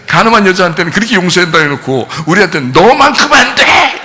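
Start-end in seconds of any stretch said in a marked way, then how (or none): 2.7-4.0 drawn-out, high-pitched mock shout; hammed-up condemning yell, stretched out; harsh rejection. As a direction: bellow a slow, exaggerated refusal. Mimics harsh rejection, then denies it.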